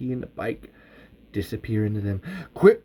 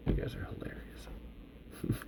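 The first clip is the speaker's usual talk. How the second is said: whispering; 17 dB quieter, slower than usual. Real, not acted. Whispered aside to writers.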